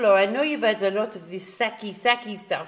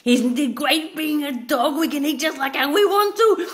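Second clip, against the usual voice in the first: lightly voiced happy dog, higher; 7 semitones higher, 4 dB louder than usual. The dog telling its owner to just eat, in a light happy dog voice. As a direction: play the dog gleefully going for it.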